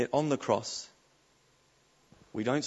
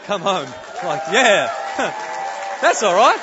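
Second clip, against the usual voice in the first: loud, high whoop of celebration; excited celebration; 7 semitones higher, 13 dB louder than usual. Retold or imitated. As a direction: shout it out, elated, with a laugh. Celebrating the good news, shouting.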